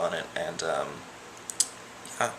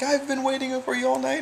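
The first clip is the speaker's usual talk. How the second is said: much higher, a gushing mock yell; imitating a yell; 3 dB louder than usual. Friend Anuja yells at the guy, gushing mock yell. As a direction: call out to him like a clingy drunk friend. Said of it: "She's yelling at this guy".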